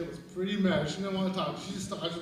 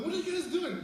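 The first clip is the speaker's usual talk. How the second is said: high-pitched startled question; alarmed demand; 10 semitones higher than usual. Retold or imitated. Lisa pulling in on boys boxing, alarmed demand. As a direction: imitate a grown-up catching kids at it.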